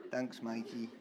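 normal talking voice

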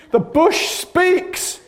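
high, slow, laughing wonder; amazed. Real, not acted. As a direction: marvel at it, laughing.

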